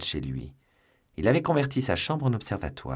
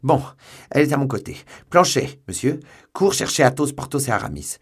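louder, higher urgent orders; urgent shouting; 6 semitones higher, 9 dB louder than usual. A narrator's reading. D'Artagnan shouting to his servant Planchet, urgent. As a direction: bark orders while grabbing the sword.

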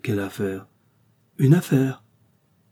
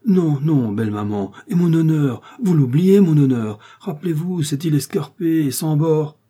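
higher and louder mock-heroic protest; declaiming honour; 6 semitones higher, 6 dB louder than usual. Bouginier grandly protests to his mother-in-law, louder. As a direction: puff up over his honour.

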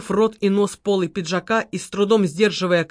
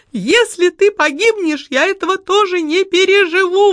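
высоко, с отчаянием, чуть медленнее; отчаянно воскликнуть, цепляясь за него; восклицание; 10 semitones higher, 4 dB louder than usual. A narrator's reading. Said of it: "воскликнула Лариса Львовна". (высокое отчаянное восклицание)

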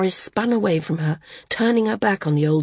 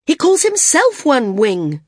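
higher, louder heated retort; voice rising; 6 semitones higher, 5 dB louder than usual. A narrator's reading. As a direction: raise the voice in protest. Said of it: "her voice rising once more".

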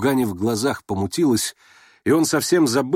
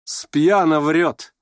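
slow, higher-pitched taunt; teasing; 5 semitones higher, 3 dB louder, much slower than usual. A narrator's reading. Nastasya Filippovna goading Rogozhin, slow teasing. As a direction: drawl it to needle him. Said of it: "как бы поддразнивая его".

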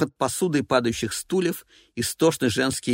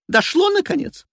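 shrill diva voice; 5 dB louder than usual. Acted. Evelina voiced.

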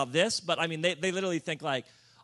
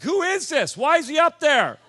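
much higher, louder indignant call-out; loud heckler; 9 semitones higher, 12 dB louder than usual. Retold or imitated. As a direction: heckle from the back of the room. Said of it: "They were loud about it".

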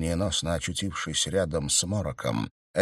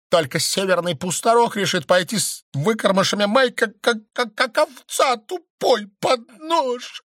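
high, loud, spluttering southern stranger; stream of abuse; 5 dB louder than usual. A narrator's reading. Stranger swears at the count, spluttering high fury. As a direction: stammer with rage, voice climbing higher. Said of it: "лилась такая отборная брань".

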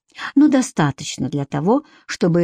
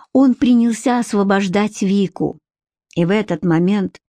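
ordinary delivery

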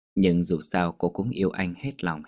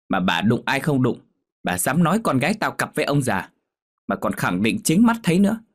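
loud, high, furious fishwife tirade; angry scolding; 5 semitones higher, 3 dB louder than usual. A narrator's reading. Big fishwife yelling at Hai Nhung about her mother, furious scolding. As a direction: tear into the girl about her gossiping mother.

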